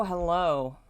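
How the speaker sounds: ordinary talk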